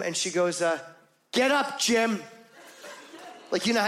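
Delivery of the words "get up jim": a hint of a cold, ominous "Get up, Jim"; ominous girlfriend. Girlfriend waking him with a mildly ominous tone. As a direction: let it land like bad news is coming. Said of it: "the tone of voice implies this is not gonna be great news".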